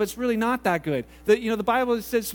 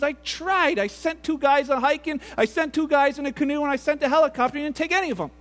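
higher, insistent mock exasperation; exasperated reply; 5 semitones higher than usual. God answering the man in heaven, exasperated. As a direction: list your efforts in acted frustration.

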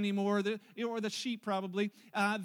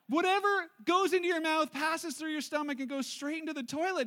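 higher, punchier repeat of the verse; emphatic retelling; 6 semitones higher, 3 dB louder than usual. Acted. Pastor relishing the toilet verse for the congregation, emphatic. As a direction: give the toilet line full gusto.

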